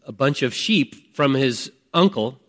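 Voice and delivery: usual speaking voice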